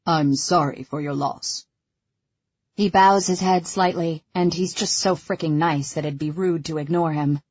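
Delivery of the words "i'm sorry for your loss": lightly voiced deep, Batman-like driver; a touch of deep-voiced solemn condolence; Duran's deep voice. A narrator's reading. Driver consoling Ella on her loss, lightly deepened voice. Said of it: "in a ridiculously deep voice".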